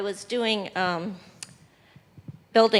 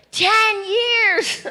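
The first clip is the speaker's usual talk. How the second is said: much higher and louder, amazed exclamation; astonished; 13 semitones higher, 7 dB louder than usual. Real, not acted. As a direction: burst out in disbelief. Astonished at how long.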